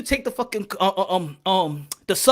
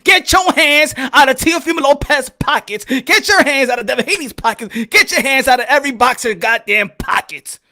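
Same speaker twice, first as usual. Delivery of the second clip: ranting call; 3 semitones higher, 6 dB louder than usual. Real, not acted. Ranting at boxing fans.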